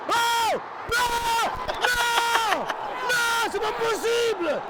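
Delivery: anguished shouts of no. Real, not acted. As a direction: yell in disbelief at the goal. Watching PSG concede goal live.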